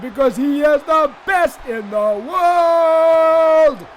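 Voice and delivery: drawn-out proclamation like a ring announcer; hype shout. Real, not acted. Commentator proclaiming Shane the best in the world, hammed-up hype.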